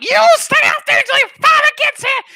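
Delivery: high, screeching mock-mom yell; hammed-up shrieking parental threat; furious mom. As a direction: scream it up the stairs like an enraged mother. Acts furious mom yelling upstairs.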